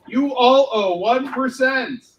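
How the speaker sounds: loud, high, slow declaration; mock proclamation. Creator proclaiming his superhero's tax demand, loud slow mock announcement. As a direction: proclaim it slowly like a cartoon hero.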